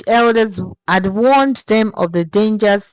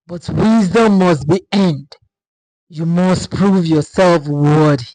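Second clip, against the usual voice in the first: louder, booming forest spirit voice; grand spirit voice, louder and resonant; deep rumbling spirit; 5 dB louder than usual. A narrator's reading. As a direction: speak as an ancient spirit of the forest. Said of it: "his voice deep and rumbling like thunder".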